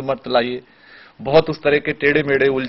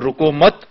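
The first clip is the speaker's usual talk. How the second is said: "रुको मत" as one unit, then a break; pause after मत; 3 dB louder, slower than usual. Shown as a demonstration. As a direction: run रुको into मत and stop. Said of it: "pause दे दिया". ("रुको मत" एक साथ, फिर विराम)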